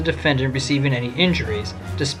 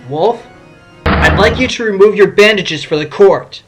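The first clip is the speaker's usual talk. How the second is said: loud, higher-pitched insistence; firm request; 5 semitones higher, 8 dB louder than usual. Acted. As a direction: address the witness loudly for the whole court. Lawyer calls unresponsive witness firmly.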